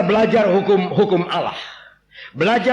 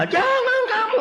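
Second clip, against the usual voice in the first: very high laughing cry; laughing shout. Acted. Preacher shouts half a commandment laughing.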